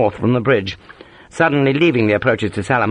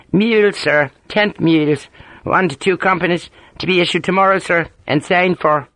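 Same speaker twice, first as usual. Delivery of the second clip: put-on Ulster accent; 8 semitones higher than usual. A narrator's reading. Harper answering in his accent.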